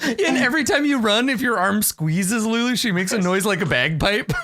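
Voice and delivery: usual speaking voice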